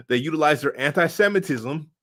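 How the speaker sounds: laughing mid-sentence; words broken by laughter. Real, not acted. Laughing through a jab.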